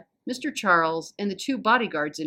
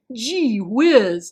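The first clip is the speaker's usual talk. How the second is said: loud, higher hammed-up Texan cowboy "Gee whiz"; fake cowboy accent; 4 semitones higher, 8 dB louder than usual. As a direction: belt it like an old-movie cowboy. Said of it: "like a fake cowboy in an old movie".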